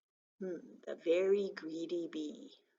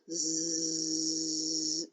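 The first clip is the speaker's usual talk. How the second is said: long, low, steady "zzz"; sleep buzz; 4 semitones lower than usual. Pretend. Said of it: "There's those sleep letters".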